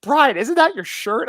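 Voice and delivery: mock surprised friend; imitating Liz. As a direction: Liz spotting the shirt, voice up. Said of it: "Liz goes".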